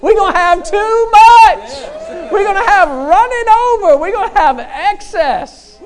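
crescendo into a soaring preacher's cry; building excited shout. Pretend. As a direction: start loud, then climb high and stretch the last lines. Husband and wife talking excess.

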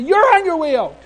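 high, loud final verdict; angry order. Retold or imitated. Immigration minister slapping her hand, angrily ordering him out. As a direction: bang out the dismissal like an irate official. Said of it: "She slaps her hand and says".

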